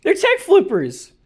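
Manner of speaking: high, loud and fired up; excited. Real, not acted. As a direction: hype it up like a rant. Hyped rant about tech flipping.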